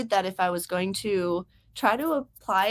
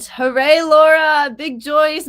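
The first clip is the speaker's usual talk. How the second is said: loud, drawn-out hooray rising higher; cheering; 6 semitones higher, 10 dB louder, slower than usual. Real, not acted. Host celebrates a viewer's news, loud high cheer. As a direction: cheer them on loudly, stretching the words.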